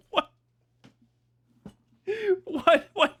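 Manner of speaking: high disbelieving What; stunned, pitch jumps up; incredulous. Real, not acted. Incredulous repeat.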